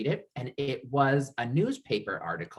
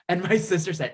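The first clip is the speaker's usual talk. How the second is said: ordinary talk, nothing marked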